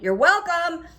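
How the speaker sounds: "You're welcome" loud and high, pointed sarcasm; sarcastic you're welcome. Retold or imitated. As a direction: fling it after the rude shopper. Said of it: "I just went".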